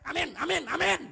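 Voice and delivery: loud, mocking youngsters' amen; imitated yelled amens. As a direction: mimic youngsters yelling amen from the back. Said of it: "di belakang dia teriak". (tiruan teriakan amin yang lantang)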